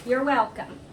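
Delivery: normal talking voice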